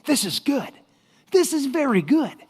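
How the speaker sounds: high, upbeat servant voice; relentlessly positive. Acted. Servant's stock reply to whatever happens, relentlessly positive. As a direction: chirp it with cheerful conviction. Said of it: "infectiously positive attitude".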